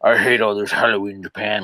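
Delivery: crusty gaijin caricature, cartoonish grumble; mock a grumpy complainer in a put-on voice; exaggerated grouchy groan into cartoon whining; South Park-style voice. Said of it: "sound like South Park characters when you do that voice".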